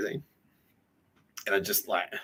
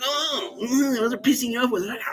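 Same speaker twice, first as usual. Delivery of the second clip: mock whine; 14 semitones higher than usual. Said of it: "He's like".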